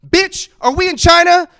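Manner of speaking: high, loud outraged yell; loud incredulous yell at his mother; yelling at mom. Retold or imitated. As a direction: blurt it out in a furious shout. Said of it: "I said, um".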